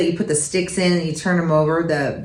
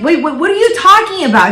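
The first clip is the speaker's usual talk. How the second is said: much higher and louder; mock disbelief; 10 semitones higher, 5 dB louder, faster than usual. Mom retelling her shocked reaction to her daughter, high and loud. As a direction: sound floored by the excuse.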